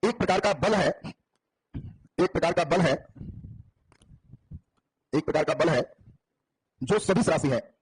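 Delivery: unhurried classroom dictation. Acted. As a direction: read it out word by word for the class to write down. Teacher dictates notes slowly.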